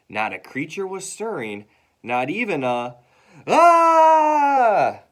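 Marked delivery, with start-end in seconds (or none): 3.5-5.0 loud, high, long scream; falling scream. Acted. Teacher falls mid-poem, loud high scream. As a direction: scream out as the chair collapses.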